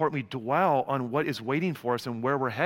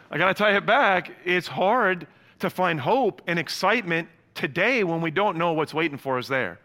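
ordinary talk

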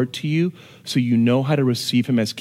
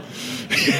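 breathy laugh; 5 dB quieter than usual. Real, not acted. Laugh after story.

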